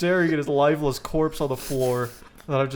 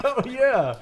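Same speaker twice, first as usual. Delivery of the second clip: laughing; 3 semitones higher, 4 dB louder, slower than usual. Retold or imitated. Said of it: "I'm like".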